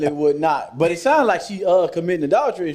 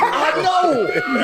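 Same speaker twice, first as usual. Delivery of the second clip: mock shout; 8 semitones higher than usual. Voicing the ex in retold scene.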